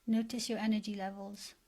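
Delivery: guided meditation. Acted. Coach leading listeners to picture their best life, guided meditation. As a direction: guide them gently, leaving space after each phrase.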